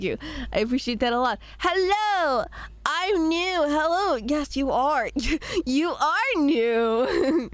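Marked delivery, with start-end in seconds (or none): 1.6-4.2 put-on high, cheerful new kid greeting; mock newcomer. Voicing a new chatter. 5.7-7.5 put-on slow, sing-song teacher voice to a small child; kindergarten teacher. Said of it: "Sounds like I'm a kindergarten teacher".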